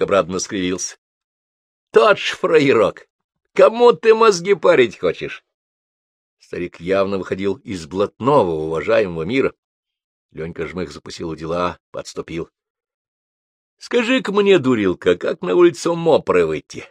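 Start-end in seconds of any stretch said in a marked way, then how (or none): 1.4-5.4 put-on much higher, louder sneering old man; mock the punk with glee; gloating thug. A narrator's reading. Gloating thug mocks the boy. 13.8-16.8 put-on higher, teasing old crook asking the way; grumbling old man. A narrator's reading.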